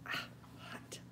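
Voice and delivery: whispered at the heat; whispering. Real, not acted. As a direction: breathe it out in a whisper. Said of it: "I'm whispering because I'm afraid my camera's gonna fall".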